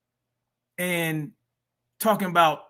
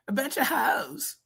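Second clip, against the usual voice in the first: high, slow, softer imitation; mocking the inflection; 7 semitones higher, 5 dB quieter, slower than usual. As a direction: draw out the odd inflection in a high voice. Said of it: "what are these inflections?".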